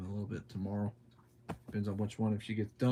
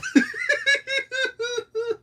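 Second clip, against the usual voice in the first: very high, crying laughter; real high, teary laughing fit; shrieking laugh; 9 dB louder than usual. Real, not acted. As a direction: laugh until it squeaks. Laughing hard at comment.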